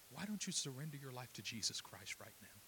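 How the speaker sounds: very soft, low, gentle invitation; hushed appeal. Retold or imitated. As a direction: plead gently, almost under your breath. Gentle appeal to a weeping man.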